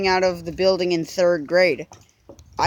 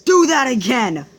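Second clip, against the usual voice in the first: high, loud furious yell; a dad yelling a stern warning; retold scream; 5 semitones higher, 5 dB louder, slower than usual. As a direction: scream it right in the kid's face. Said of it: "and then he screamed".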